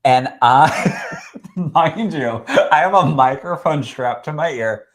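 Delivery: a real laugh breaking in mid-sentence. Real, not acted. Telling an audience about sobbing, laughing it off. Laughing at herself crying onstage.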